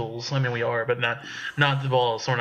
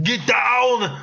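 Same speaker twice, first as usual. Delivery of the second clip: higher, louder action hero yell; action movie shout; 8 semitones higher, 3 dB louder than usual. Pretend. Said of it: "pull a little Pablo Francisco".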